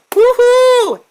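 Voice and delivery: loud high cheer; excited whoop. Real, not acted. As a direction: whoop it up like a game show host. Host hypes the alien entrance.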